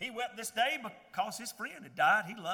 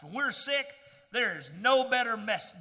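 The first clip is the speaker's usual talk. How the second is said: normal talking voice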